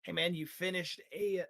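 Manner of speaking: ordinary delivery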